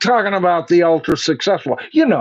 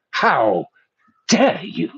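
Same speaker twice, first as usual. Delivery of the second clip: a light mock of an outraged teen activist; lightly voiced indignant "how dare you"; Greta imitation; slower than usual. Mocking Greta's speech to listeners, a lightly voiced impression. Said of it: "that's my best Greta imitation".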